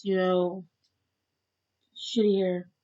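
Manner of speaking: ordinary delivery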